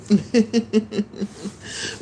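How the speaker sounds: high-pitched giggle; laughing. Real, not acted. Excited about cooking on Tuesday, high giggle. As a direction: giggle with delight.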